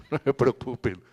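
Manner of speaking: light laugh into the reassurance. Real, not acted. Lecturer joking with the audience about refreshments, laughing. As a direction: reassure them with a chuckle.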